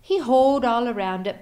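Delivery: ordinary delivery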